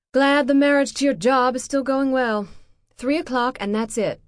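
cutting sarcasm at her ex; sarcastic. Acted. Ex-wife's sarcastic jab.

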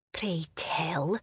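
barely audible breathy whisper; soft whisper. Acted. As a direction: lean in and whisper it close to her ear. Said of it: "Seamus whispered so softly".

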